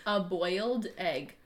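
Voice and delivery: slow exaggerated mouthing; over enunciating. Shown as a demonstration. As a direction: stretch each word out for her to read. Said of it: "What was it?".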